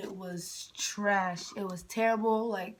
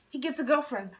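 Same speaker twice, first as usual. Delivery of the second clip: usual speaking voice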